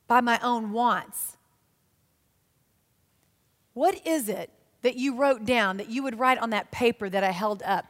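ordinary delivery

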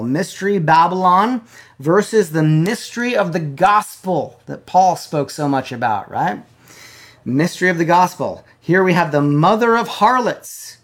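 loud, high fiery preaching; preaching at full force. Acted. Preacher driving home blasphemy to his online church, loud and fervent. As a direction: thunder it at the congregation.

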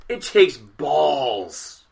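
"balls" stretched out very slowly; slow, weighty "balls" to land the joke; drawn-out emphasis. Real, not acted. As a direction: lean long and hard on "balls".

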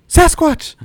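high, loud excited yell; excited shout. Retold or imitated. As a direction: shout the name with glee. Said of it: "He's like".

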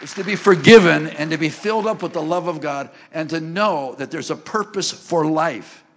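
preaching at full force. Acted. Preaching the purpose of life.

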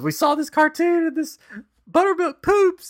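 put-on high, loud excited kid voice; excited little son. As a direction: imitate a small boy bursting with news. Voicing young son telling mom.

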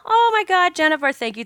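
high-pitched, real excited gratitude; gushing thanks. Real, not acted. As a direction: burst out high, then gush thanks. Gushing thanks to listener who messaged.

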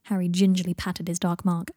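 usual speaking voice

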